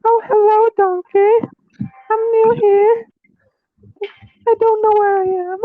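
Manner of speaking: put-on high, breathy new-girl act; girly voice. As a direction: play a shy lost girl. Male friend playing new girl.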